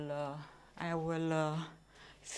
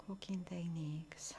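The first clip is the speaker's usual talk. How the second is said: breathless whisper, no voice at all; 2 semitones lower, 11 dB quieter than usual. Real, not acted. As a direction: whisper it so no one wakes. Whispering while reading.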